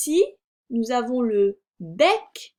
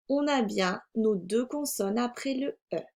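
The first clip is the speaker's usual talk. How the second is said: ordinary delivery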